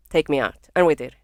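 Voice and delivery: ordinary talk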